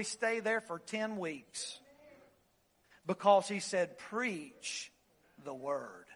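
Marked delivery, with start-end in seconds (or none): 4.0-6.1 much softer, the word very low; almost breathe it; hushed emphasis. Shown as a demonstration. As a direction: Preach the word sinking to a hush. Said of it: "puts the emphasis on the first three words".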